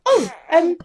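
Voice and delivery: high, flustered helpful voice; flustered eager helper. Retold or imitated. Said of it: "was like".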